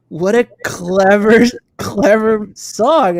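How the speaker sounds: high, loud, drawn-out marvelling; amazed admiration. Retold or imitated. Fan retelling reading the lyrics, amazed admiration. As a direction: stretch each word in delighted awe.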